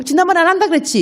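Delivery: hammed-up nagging with "한다" stressed and falling; mock nagging. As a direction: throw "지난번에 안 한다 그랬지?" back as a high accusing jab. Repeats the nagging line.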